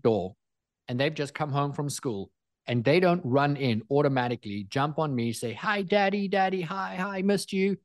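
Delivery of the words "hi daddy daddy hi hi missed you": put-on high, excited little kids greeting their dad; eager kids. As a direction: bounce it up like a child running to hug him.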